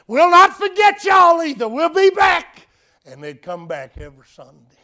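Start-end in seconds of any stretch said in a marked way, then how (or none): 0.1-2.7 loud, high announcement to a crowd; calling to the church. Retold or imitated. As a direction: act out a groom's ringing promise to everyone. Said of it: "he said to the church".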